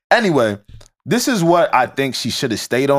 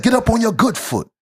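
slightly higher jittery crackhead line; 6 semitones higher than usual. Shown as a demonstration. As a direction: toss it off like a twitchy addict. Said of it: "I'm trying to read in crackhead".

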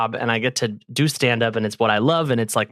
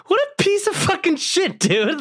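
high-pitched mock rant; angry outburst. Comedian retelling how he cursed the other comic. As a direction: shriek the insult in disbelief. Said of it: "And I was like".

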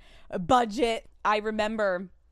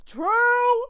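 squealed agreement; 9 dB louder than usual. Real, not acted. Agreeing with the card.

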